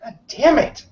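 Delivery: normal talking voice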